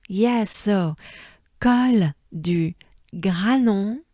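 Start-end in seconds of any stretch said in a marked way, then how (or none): 1.6-4.0 col du Granon slow and loud; slow drilled col du Granon; slow French name. Shown as a demonstration. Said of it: "pronunciation drill".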